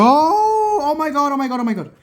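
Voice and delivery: high shrieking disbelief; shrieking jackpot winner. Real, not acted. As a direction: squeal with sheer joy. Screen repair not cracked, ecstatic.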